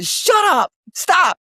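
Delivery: sudden high-pitched shout of stop; shouted rebuke. Real, not acted. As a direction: yell shut up at the self-doubter. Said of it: "Because you will begin to believe it".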